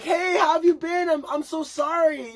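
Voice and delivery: high, falsetto fake-nice voice; mock the friend with a syrupy high apology; sugary sing-song; fake sweet apology.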